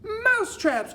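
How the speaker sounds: very high, loud exclamation; acted high-pitched crowd cry; witches' cry. A narrator's reading. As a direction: shriek it back like astonished witches. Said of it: "cried the witches".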